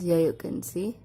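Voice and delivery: ordinary delivery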